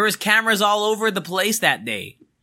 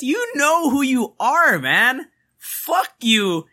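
you know who you are, slower and higher; pointed high callout; 7 semitones higher, much slower than usual. Real, not acted. Telling the client off on the podcast, higher and slower. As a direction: call them out, drawn out and higher.

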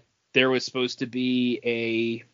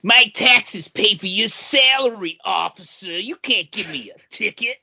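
entitled citizen; 9 semitones higher, 5 dB louder than usual. Retold or imitated. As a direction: lecture the officer like an outraged taxpayer. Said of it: "one of those people that are like".

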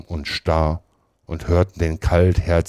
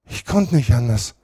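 stöhnend; 7 semitones higher, 3 dB louder than usual. A narrator's reading. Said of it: "stöhnte Potter".

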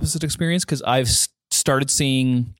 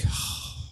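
deflated sigh; 11 dB quieter than usual. Retold or imitated. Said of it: "I do this shutdown thing where I'm just like".

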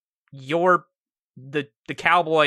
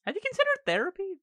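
high mock therapist voice; 7 dB quieter, faster than usual. GM voicing NPC suggestion.

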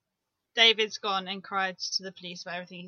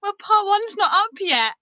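high, loud, slowed mum complaint; mock-indignant mum, pitched right up; imitating mum; 10 semitones higher, 9 dB louder than usual. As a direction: voice mum's message high and emphatic. Voicing mum's message, teasing.

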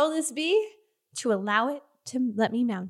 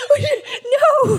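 high, loud cry of disbelief; dismayed shout; 12 semitones higher, 9 dB louder than usual. Acted. Player sees the touched creature die, dismayed shout. Player reacts to accidental kill.

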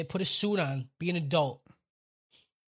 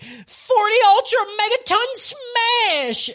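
high, drawn-out anime battle shout; hammed-up villain power-move yell; full rage attack cry; 9 dB louder, much slower than usual. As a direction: bellow your special move, stretching every word. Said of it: "goes into full rage mode".

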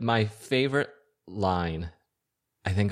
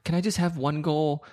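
pleading husband; 7 semitones higher than usual. Retold or imitated. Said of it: "And he's like".

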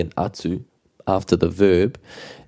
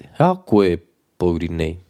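"He aha koe" raised, "pauri nei" dropping away; high question word. Shown as a demonstration. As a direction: lift "He aha koe", then let "pauri nei" fall. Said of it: "Question word, subject".

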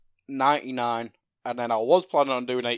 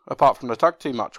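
normal talking voice

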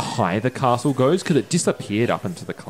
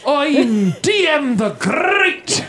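grandiose herald; 3 dB louder, slower than usual. Acted. Grand self-introduction in character.

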